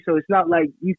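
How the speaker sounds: ordinary delivery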